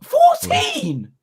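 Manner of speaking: squeaky high-pitched disbelieving fourteen; shocked disbelief. Real, not acted. Hearing Baby Shark has fourteen billion views, shocked high squeak. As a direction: yelp the number in shock, voice cracking up high.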